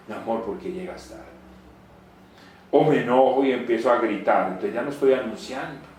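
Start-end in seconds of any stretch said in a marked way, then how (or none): 0.1-1.2 much softer, lower, gently asking; wife softly asking her husband why he's late; soft loving question. Acted.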